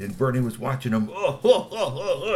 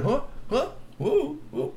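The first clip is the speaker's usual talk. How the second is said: high startled whoa; slower than usual. A narrator's reading. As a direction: gasp out a whoa. Bernie startled.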